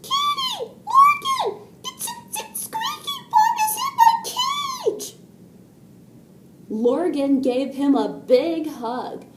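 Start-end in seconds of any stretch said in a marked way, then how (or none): 0.0-5.2 very high squealing mouse voice, stammering; panicked yelling. A narrator's reading. Little mouse runs into the bedroom, panicked high yelling. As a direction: squeal for help, tripping over the words. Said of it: "He ran into their bedroom yelling".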